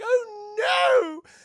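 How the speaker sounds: high, loud "oh no" wail; dismayed shout. Acted. Gargoyles find their friends eviscerated, dismayed shout. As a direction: cry out in mock horror.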